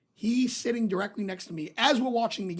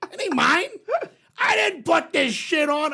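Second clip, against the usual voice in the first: louder, slower protest of innocence; mock indignant shout; 7 semitones higher, 3 dB louder than usual. Teasing the old man about his own phone, mock indignant shout. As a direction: shout it slowly, playing innocent. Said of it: "I go".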